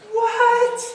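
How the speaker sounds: high what; a squeak of shocked surprise; surprised yelp. Real, not acted. Preacher reacting to the roof being dug, a high yelp.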